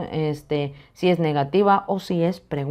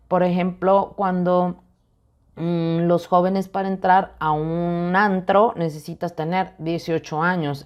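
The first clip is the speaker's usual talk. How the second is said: usual speaking voice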